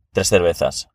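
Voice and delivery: ordinary delivery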